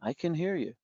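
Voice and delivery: softly imitated calm reply; hushed answer to someone screaming; low, near-whisper. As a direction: drop the volume for a quiet, restrained comeback. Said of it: "very low voice, almost a whisper".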